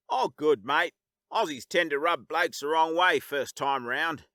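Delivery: put-on relaxed Australian accent; calm Aussie. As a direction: reassure him easy, Australian style. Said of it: "he said calmly".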